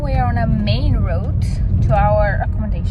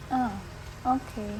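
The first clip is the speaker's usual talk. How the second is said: quiet shrug; 17 dB quieter than usual. Retold or imitated. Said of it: "And she's like".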